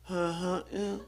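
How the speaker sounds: mumbled, wordless carny grunt; garbled carny mumble; carny server. Retold or imitated. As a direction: mimic a server's unintelligible counter talk. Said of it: "She did the same thing".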